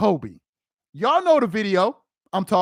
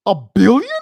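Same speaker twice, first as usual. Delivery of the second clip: louder incredulous question; 3 semitones lower, 7 dB louder than usual. Real, not acted. Disbelief, louder repeat.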